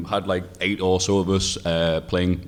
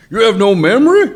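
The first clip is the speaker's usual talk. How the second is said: put-on a shocked, much higher and louder Ohio accent; 12 dB louder than usual. An Ohio fan shocked he forgot, in an Ohio accent. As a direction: squawk it in disbelief with an Ohio accent. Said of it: "I'll stop doing the accent".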